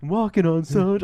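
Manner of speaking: high-pitched, giddy lilt; skipping along on a high. Retold or imitated. Fan skipping back to the booth, giddy. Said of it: "I was, like, so giddy".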